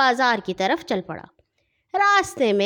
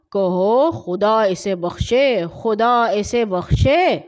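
solemn prayer; 3 dB louder, slower than usual. Acted. Mourner teaches a prayer.

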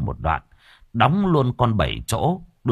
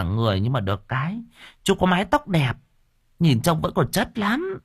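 high, teasing woman's voice; sassy stall woman; 7 semitones higher than usual. Acted. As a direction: scold him playfully, squeamish. Stall owner flirts back. (bà chủ quán đanh đá, giọng cao trêu chọc)

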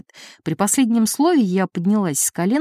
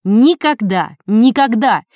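louder, hammering never twice; furious loud refusal; 3 semitones higher, 6 dB louder, slower than usual. A narrator's reading. Wife refusing husband money, loud hammered never. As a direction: slam the door on him with each word. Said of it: "Она была страшна".

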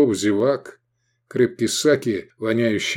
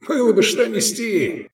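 chortling; 12 semitones higher than usual. A narrator's reading. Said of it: "хохотнул Мирарзал".

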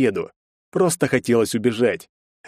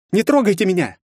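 put-on frightened girl; 10 semitones higher, 4 dB louder than usual. Scared girl fights off thugs.

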